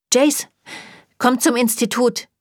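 lightly voiced shrill seriousness on the phone; serious, shrill. A narrator's reading. Isabel phones Jace to summon him, serious and shrill. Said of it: "sagte sie ernst. Ihre Stimme klang schrill".

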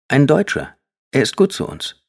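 put-on boy speaking up to his mother; boy's voice. A narrator's reading. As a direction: voice the son, bright and keen. Jay defends Heinz.

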